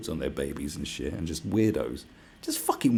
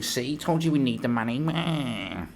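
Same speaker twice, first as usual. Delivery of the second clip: high put-on whiny voice; mock whining; 7 semitones higher, 3 dB louder, slower than usual. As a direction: imitate a whining I-told-you-so. Same mocked beggars saying told you.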